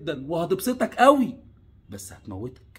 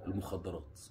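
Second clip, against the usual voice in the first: dropped low; 9 semitones lower, 14 dB quieter than usual. Real, not acted. Drops to name the danger.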